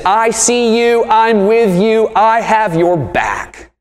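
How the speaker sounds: high, slow, gentle reassurance; a lightly voiced comforting Jesus; tender Jesus. Preacher voicing Jesus to the grieving, slow and tender. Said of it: "Jesus actually says".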